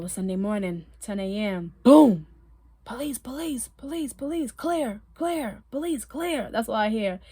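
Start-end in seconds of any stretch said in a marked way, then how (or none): 1.8-2.3 loud, sudden boom; imitated bang. Imitates the raid's door bang. 2.8-6.5 imitated police calls. Imitates police calling out during raid.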